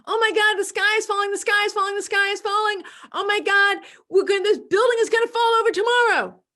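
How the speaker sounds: high, loud frantic panic; panicked alarm. Pretend. Someone panicking about the sky falling, frantic and loud.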